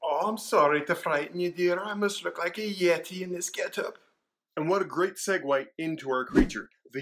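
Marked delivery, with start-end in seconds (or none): 0.0-4.0 put-on high, sweet old Scottish nanny. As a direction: play a fussy old nanny. Parody scene, Mrs Doubtfire voice.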